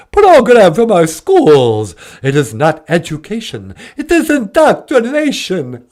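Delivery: excited, hammy vampire with staccato cackles; Count Von Count. Acted. Count ranting to the host about schools, theatrical vampire voice. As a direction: declaim it in a swooping Transylvanian accent.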